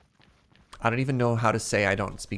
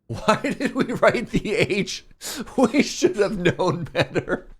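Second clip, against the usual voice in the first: high, laughing self-reproach; real laughing embarrassment; laughing lament; 9 semitones higher, 5 dB louder, slower than usual. Real, not acted. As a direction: laugh through the complaint. Laughing at own spelling mistake.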